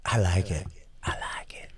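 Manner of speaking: hushed, voiceless whisper. Acted. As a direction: whisper it under your breath. Hushed echo of liking it.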